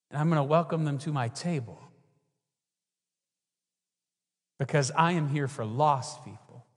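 Jesus telling the Pharisees, softly earnest; quiet, earnest. Retold or imitated.

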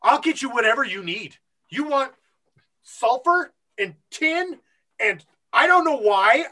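loud, high, gung-ho promoter; eager showman. Retold or imitated. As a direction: play an impresario egging on the act. Voices showman urging Aludra on.

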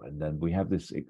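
ordinary talk

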